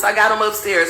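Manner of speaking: ordinary delivery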